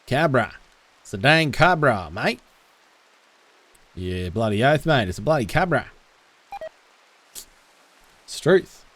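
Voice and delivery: hammed-up broad Australian bogan; full Aussie accent. Streamer jokes about the cobra, full-on Aussie accent. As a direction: lay the Aussie accent on thick. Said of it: "Never go full Aussie".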